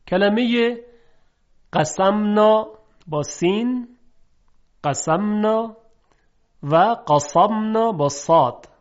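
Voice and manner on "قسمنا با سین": ordinary talk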